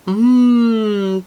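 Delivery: ordinary delivery, nothing marked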